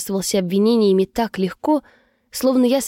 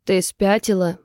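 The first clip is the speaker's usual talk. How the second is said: plain reading voice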